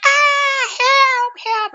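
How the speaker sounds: high, loud imitated cry for help; mock cry for help. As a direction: yell for help like you're panicking. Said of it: "Was screaming and hollering".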